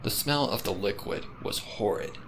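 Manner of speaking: ordinary delivery, nothing marked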